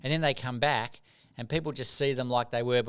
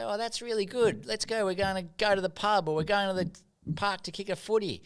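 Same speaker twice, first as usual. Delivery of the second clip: higher, bouncy mates; cheery friends; 8 semitones higher than usual. Retold or imitated. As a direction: mates keen to head to the pub. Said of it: "And they'd say".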